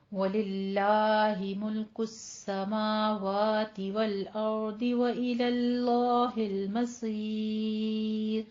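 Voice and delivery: slow melodic tajweed recitation; Quran recitation. Acted. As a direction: chant the verse slowly and evenly. Recites the verse.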